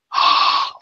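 sharp, voiceless intake of breath; surprised gasp. Real, not acted. Shopper hearing the store may have a wolf, surprised gasp. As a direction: gasp at exciting news. Reacts to possible item in store.

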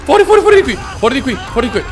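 high, loud panicked yelling; real panic. Real, not acted. Player fleeing a disgusting vision, panicked yelling. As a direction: yell it over and over, desperate to get out.

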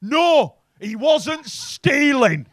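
loud, slow, outraged security guard; slow shouted complaint; shouting security guard. Retold or imitated. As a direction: bellow it like an indignant guard. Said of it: "The security guard looked at me and went".

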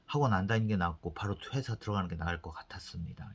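hushed, unvoiced retelling of his line; whispered quote. Cousin quietly admitting to boss, whispered. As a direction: whisper it like a sheepish confession. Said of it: "나중에 학원 나와서 한마디 했지".

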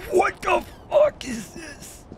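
high, drawn-out startled cry; slow, high-pitched disbelief after a gasp; alarmed shock. Real, not acted. Shocked at a sudden discovery.

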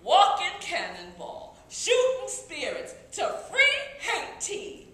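high, loud, chant-like proclamation; rousing battle cry. Acted. Poet telling of Toussaint's army freeing Haiti, rousing. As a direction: build it like a call to arms.

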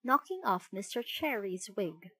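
usual speaking voice

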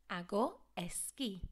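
esquí with a clean "key" sound, stress on the end; model esquí. Shown as a demonstration. Teacher modelling esquí for pupils, crisp key sound. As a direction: say esquí like a key in a door, loud and clear. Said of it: "I want to hear esquí".

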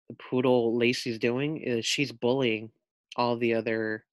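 ordinary talk, nothing marked

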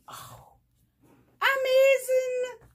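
loud, very high, drawn-out squeal; thrilled exclamation. Real, not acted. Vlogger unwrapping a friend's gift, thrilled. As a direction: squeal it slowly with delight.

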